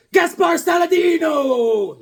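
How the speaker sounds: big slow shouted name reveal; drawn-out shouted announcement; announcer shout. Acted. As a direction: boom it out like a ring announcer.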